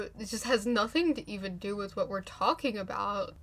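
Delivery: ordinary delivery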